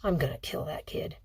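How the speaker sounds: low, whispered growl through clenched teeth; through gritted teeth. A narrator's reading. Father muttering threat about the boy through gritted teeth. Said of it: "spoke through gritted teeth".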